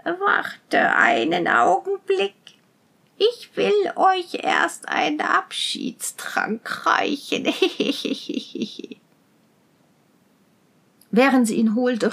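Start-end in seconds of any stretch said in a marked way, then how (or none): 0.0-7.5 high, slow crone's voice; old witch. A narrator's reading. Witch delaying the prince with a parting drink, crone voice. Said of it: "sprach die Alte". 7.5-8.9 soft, breathy evil cackle; witch's cackle. A narrator's reading. Witch plotting with her poisoned potion, cackling.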